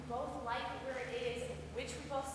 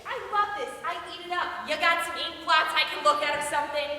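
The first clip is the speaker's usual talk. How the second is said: loud mock enthusiasm; raised-voice, biting mockery; heated sarcasm; 3 semitones higher, 9 dB louder than usual. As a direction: throw it back at them sarcastically. Mocks being analyzed, quarrel.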